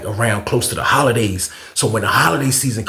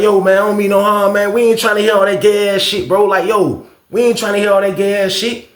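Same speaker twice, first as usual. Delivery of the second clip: higher, insistent retold complaint; acted protest; 7 semitones higher than usual. Homeboy objecting to the dude next door, raised-pitch protest. Acted quarrel between neighbouring cells.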